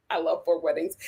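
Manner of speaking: ordinary talk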